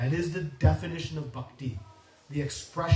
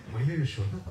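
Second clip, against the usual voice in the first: timid; 4 semitones lower, 3 dB quieter, faster than usual. Acted. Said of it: "He becomes like a mouse".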